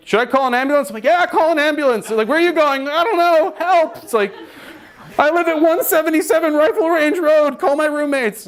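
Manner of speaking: high, strained mock panic; frantic yelps while fleeing a crime scene; panicked high voice. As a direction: squeak it out in a nervous panic. Panicked high-pitched yelling exchange.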